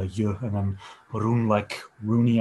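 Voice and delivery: Ye, then Roon, each part set apart; name broken into parts. Shown as a demonstration. Guest coaching the host on his name, syllable by syllable. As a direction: spell out Ye and Roon by sound, Roon rhyming with the start of Rooney. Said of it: "is probably the best way".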